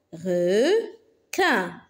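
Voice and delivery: "Requin" split into re, quin; long "re", pause, then "quin" in "Requin"; syllable by syllable. Shown as a demonstration. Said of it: "Frappe les syllabes". (« Requin » découpé syllabe par syllabe)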